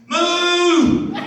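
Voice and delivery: high, loud mock cow moo. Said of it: "the Irishman went".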